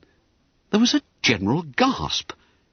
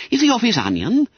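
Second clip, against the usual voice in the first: cheeky schoolboy; 11 semitones higher, faster than usual. A narrator's reading. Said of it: "whispered Bob Cherry".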